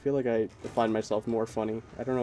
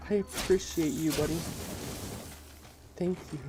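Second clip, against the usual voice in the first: mock gushing; 7 semitones higher, 4 dB quieter than usual. Gushing mock thanks to Kush.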